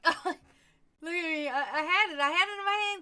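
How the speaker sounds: loud, high, laughing burst of glee; crowing over something she had in her hand; excited laughing. Real, not acted. Laughing excitedly at Kenjin about what she held.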